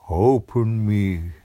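a door insisting, drawn out; second door voice. A narrator's reading. Another door pleading with the girl, slow.

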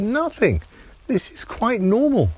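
meerkat voice. Acted. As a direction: casually shrug it off. Meerkat reassures Timmy.

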